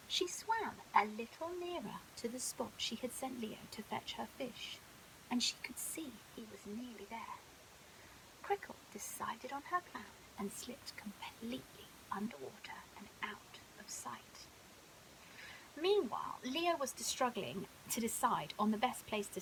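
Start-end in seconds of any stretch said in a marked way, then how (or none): 5.3-14.5 whispered bedtime storytelling; softly whispered bedtime story; hushed narration. A narrator's reading. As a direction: hush it as if sharing a secret.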